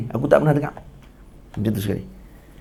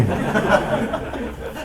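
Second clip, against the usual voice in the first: airy high giggle; high breathless laugh; 8 semitones higher, 5 dB quieter than usual. Real, not acted. Teacher giggling at his own curse joke, high and airy.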